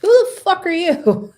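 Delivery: loud, high-pitched indignant demand; outraged outburst. Acted. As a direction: burst out, affronted and loud. Acted outraged reaction to message.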